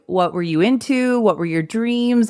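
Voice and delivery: ordinary delivery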